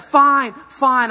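loud, exasperated giving in; fed-up surrender; grudging fine. Retold or imitated. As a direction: throw up your hands, fine. Giving in reluctantly.

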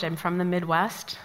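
ordinary talk, nothing marked

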